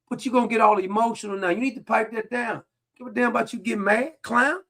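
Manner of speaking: ordinary talk, nothing marked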